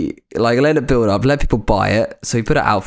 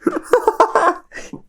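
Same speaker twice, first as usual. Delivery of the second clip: long high-pitched laugh. Real, not acted. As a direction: squeal with laughter. Laughing again.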